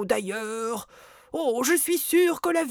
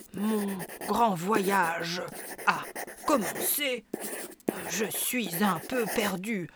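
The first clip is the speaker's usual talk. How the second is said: murmured dictation; 4 semitones lower, 6 dB quieter than usual. Acted. Writing a card aloud.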